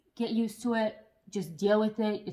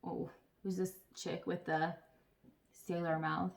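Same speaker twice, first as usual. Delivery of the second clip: taken aback; 2 semitones lower, 7 dB quieter than usual. Retold or imitated. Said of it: "everyone's always, like, taken aback".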